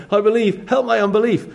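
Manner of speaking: desperate father. Retold or imitated. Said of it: "But he goes".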